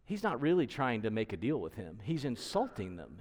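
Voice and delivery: ordinary talk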